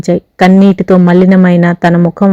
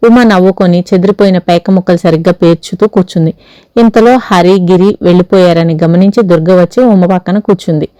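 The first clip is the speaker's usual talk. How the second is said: ordinary delivery, nothing marked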